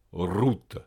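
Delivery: "route" with a doubled initial R. Shown as a demonstration. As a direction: hold a double R to start "route". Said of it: "il est redoublé dans la prononciation". (« route » avec R initial redoublé)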